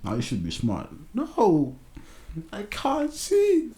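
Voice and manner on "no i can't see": very high, drawn-out exasperation; high-pitched protest. Acted. Rebutting "you should be smart", high-pitched protest. Repeats protest about wearing glasses.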